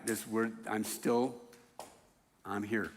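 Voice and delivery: hushed, like a confided promise; soft reassurance. Acted. Martha assuring Jesus she stays, hushed and tender. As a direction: drop to a near hush. Pastor voicing Martha's loyalty softly.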